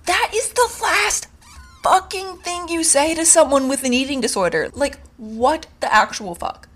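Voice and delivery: high, indignant outburst; furious disbelief at the carbs comment; outraged. Real, not acted. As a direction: let the outrage spill over.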